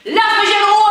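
high, loud leave me alone; loud shout. Shown as a demonstration. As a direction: yell it to make them back off. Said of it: "noch mal lauter".

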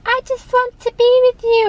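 very high, loud little creature voice; squeaky Pikachu voice. Retold or imitated. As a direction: squeak it out like a tiny cute Pikachu. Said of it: "all of a sudden Pikachu's like".

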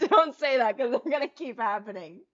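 loud protest through laughter; laughing protest. Real, not acted. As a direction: plead it while cracking up. Protests again, now laughing.

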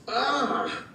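restrained high "ah" of horror; imitated Mom's cry. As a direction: mimic a mother aghast at mess. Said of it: "sounds like Mom when she sees the mess".

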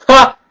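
sharp high loud "Ha!"; one loud mocking laugh; triumphant bark of a laugh. Real, not acted.

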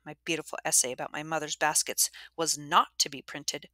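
ordinary delivery

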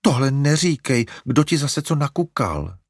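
put-on the alarmed mother hushing her boy; frightened. A narrator's reading. Mother shocked at her son's words, alarmed. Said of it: "vyděsila se maminka".

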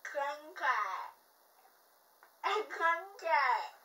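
slow, slurred through a mouthpiece; wearing a mouthguard. Real, not acted. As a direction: force words past a mouthguard. Reading card with mouthpiece in.

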